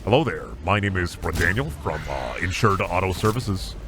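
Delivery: low, smooth telemarketer pitch voice; car warranty salesman. Pretend. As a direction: sell him the warranty like a slick salesman. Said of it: "sound like you're about to sell me an extended warranty".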